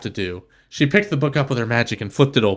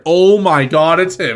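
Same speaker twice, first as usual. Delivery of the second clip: excited; 4 semitones higher, 5 dB louder than usual. Real, not acted. Excited about the stallion.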